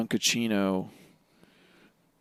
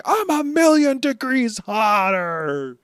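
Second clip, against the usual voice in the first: high, slow loud boast; Luffy one-upping; 11 semitones higher, 6 dB louder, slower than usual. Retold or imitated. Voicing Luffy topping the villain. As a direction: crow it way up. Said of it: "Luffy's like".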